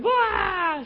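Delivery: high hammed-up child's wail; a daughter wailing again; mock wail. Child cries once more.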